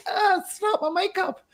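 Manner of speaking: mock him as a vain diva; whiny pampered-star parody; mocking Ronaldo. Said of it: "Ronaldo goes".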